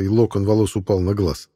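ordinary delivery

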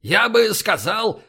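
Borovsky imitation. A narrator's reading. Linda carrying on her prank on the crew, Borovsky imitation. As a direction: impersonate with deliberate slowness. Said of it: "продолжила Линда голосом Боровского".

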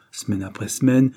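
usual speaking voice

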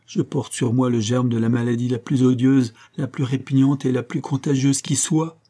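usual speaking voice

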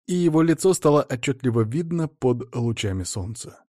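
usual speaking voice

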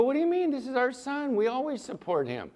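can't believe she won't come; indignant disbelief. Retold or imitated. Husband pleading with wife to come, high and incredulous.

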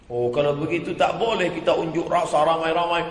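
ordinary delivery

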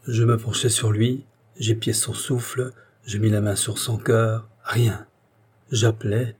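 usual speaking voice

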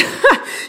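short embarrassed giggle; quick nervous giggle at her own rambling; nervous laugh. Acted. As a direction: laugh off the awkward moment. Awkward moment in timed story.

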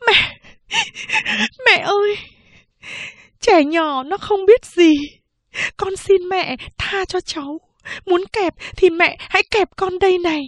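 high, sobbing plea; tearful begging. A narrator's reading. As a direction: beg for the child through tears. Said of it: "vừa khóc vừa van xin". (vừa khóc vừa van xin)

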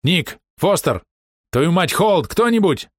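high, loud panicked calls; shouting for help. A narrator's reading. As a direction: yell for anyone to come.

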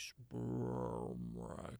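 drawn-out slow-motion word, very slow; slow-motion speech. Acted. Reassuring a teen that the word just sounds slowed, slow-motion speech. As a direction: stretch it out like slowed-down playback. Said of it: "'cause it's so slow motion".

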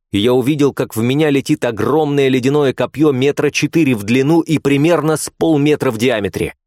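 ordinary delivery

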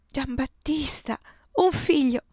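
a touch of happy sobbing in the voice. A narrator's reading. Giulia tells Giambattista of his son, tearful and joyful. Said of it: "singhiozza Giulia".

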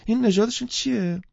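high-pitched keen curiosity; eager question. Acted. Woman asking the puppy seller about breeds, eager. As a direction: ask eagerly, pitched right up. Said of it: "با اشتیاق پرسید".

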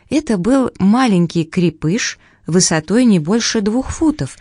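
ordinary delivery

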